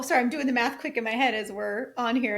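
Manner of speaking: ordinary delivery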